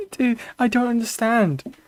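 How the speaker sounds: normal talking voice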